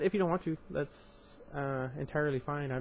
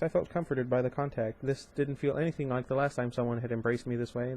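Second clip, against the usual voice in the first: ordinary delivery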